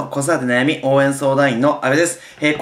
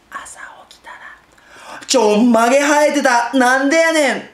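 soft then loud; 9 semitones higher, 3 dB louder than usual. Shown as a demonstration. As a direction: whisper the setup, then burst out loud. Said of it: "その手前を小さく読むと".

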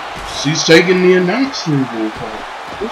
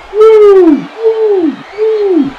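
hype chant; 6 dB louder, much slower than usual. Acted. Cheering the wrestler's entrance.

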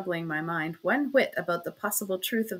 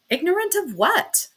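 high, sharp challenge; sharp demand; 10 semitones higher, 5 dB louder than usual. A narrator's reading. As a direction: snap the question back at her. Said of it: "demanded I, so sharply that she started".

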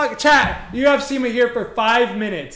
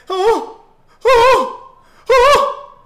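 rising excited oh's; 7 semitones higher, 5 dB louder, much slower than usual. Real, not acted. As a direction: get hyped as the shot comes. Near goal excitement.

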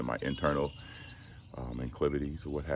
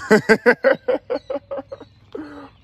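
high, loud burst of laughter; loud high cackle that tails off; 15 dB louder than usual. Real, not acted. Vlogger amused by their own joke about not meaning drugs, laughing hard.

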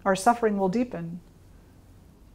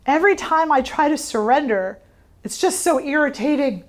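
higher, louder mock exasperation; irritated; 5 semitones higher, 4 dB louder than usual. Student complaining to her teacher, higher and louder with irritation. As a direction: imitate the student's frustrated complaint, pitched up. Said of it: "I'm getting irritated".

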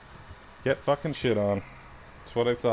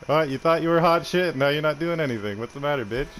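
usual speaking voice

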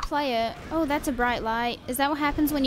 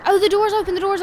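loud, high excited call; excited shout; 5 semitones higher, 6 dB louder, faster than usual. Real, not acted. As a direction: yell it out, thrilled. Door opens in zombie game.

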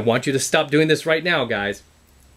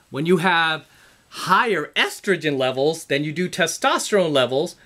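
usual speaking voice

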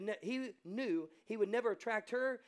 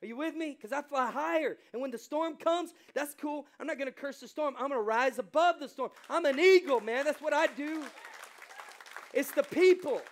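high, loud, soaring sermon delivery; preacher rallying the congregation to fly higher; full-force preaching; 7 semitones higher, 7 dB louder than usual. Acted. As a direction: rise above the storm with your voice. Preacher shouting eagle point.

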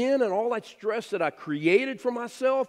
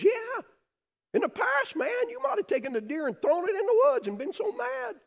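put-on much higher, earnest little girl; young daughter voice. Daughter reminding dad, higher girlish earnest voice. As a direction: lift way up like his young daughter.

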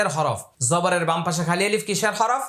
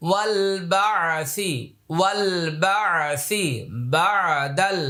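each word slowly, one by one; 2 semitones higher, much slower than usual. Shown as a demonstration. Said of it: "আমি প্রত্যেকটা আলাদা আলাদা করে বলছি". (প্রত্যেকটা আলাদা করে ধীরে ধীরে)

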